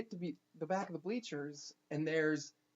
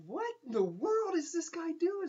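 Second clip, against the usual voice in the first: high-pitched incredulous reaction; baffled, high-voiced question; mock bewilderment; 12 semitones higher, 3 dB louder than usual. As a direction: pitch jumps up in retold disbelief. Retold baffled reaction to creepy fan.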